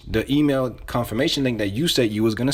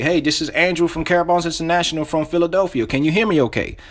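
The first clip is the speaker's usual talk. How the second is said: upbeat, higher-pitched sales greeting; enthusiastic; 5 semitones higher than usual. Shown as a demonstration. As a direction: open the cold call with real energy. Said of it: "you gotta say this with enthusiasm".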